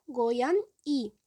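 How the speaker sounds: goyan-i drawn out slowly, every syllable clear; goyan-i at half speed; slow Korean word. Shown as a demonstration. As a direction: spell it out with your voice. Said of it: "gato em coreano".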